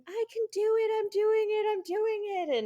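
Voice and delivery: high-pitched imitation of a small child; little girl. Daughter running up a hill on video, imitated high child voice. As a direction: chant it high, like a determined little kid. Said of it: "cute little video of Lily running".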